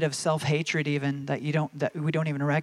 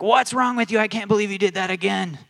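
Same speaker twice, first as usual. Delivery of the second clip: higher and louder, outraged rebuke; angry rebuke; 5 semitones higher, 6 dB louder than usual. Retold or imitated. God as we imagine him, rebuking our mistake. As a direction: let the outrage boil over.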